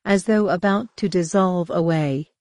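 ordinary delivery

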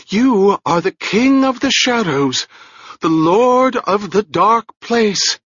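fervent exaltation. A narrator's reading. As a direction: proclaim him like a dark lord.

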